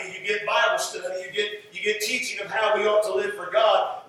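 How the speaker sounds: ordinary talk, nothing marked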